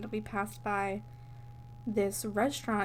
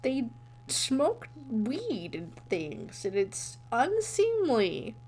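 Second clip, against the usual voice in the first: slow, put-on old man voice; slowly, like a stuffy old grandpa disapproving; old person voice; slower than usual. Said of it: "that's my best old person voice".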